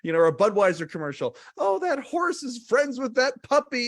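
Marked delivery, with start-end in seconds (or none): 1.6-3.9 higher and louder, hammed-up sentimental gush, laughing through it; mock-sentimental voice laughing at himself. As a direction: gush over the horse and puppy like a teary fan. Voicing his gushing inner reaction.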